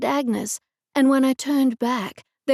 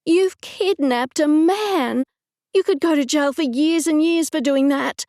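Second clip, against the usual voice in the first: horrified; 5 semitones higher, 4 dB louder than usual. Acted. Narrator horrified at kidnapping.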